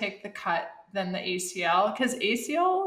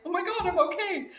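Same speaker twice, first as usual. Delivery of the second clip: dazed relief; 8 semitones higher, faster than usual. Retold or imitated. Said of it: "I'm like".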